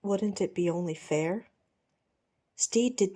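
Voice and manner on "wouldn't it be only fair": usual speaking voice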